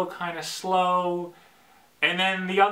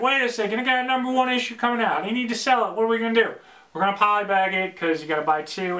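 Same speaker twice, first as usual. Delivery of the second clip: higher, quick mock planning voice; mock scheming writer; 6 semitones higher, faster than usual. Vlogger imitates a writer planning to sell issue one, mock urgent. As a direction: act out a writer cooking up a sales gimmick.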